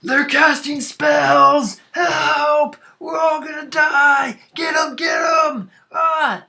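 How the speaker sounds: high, loud screams of a panicking miner; panicked yelling. Acted. Miner to fellow miners under spell attack, panicked yelling. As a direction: yell for help at full pitch. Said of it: "hear one of them's yelling".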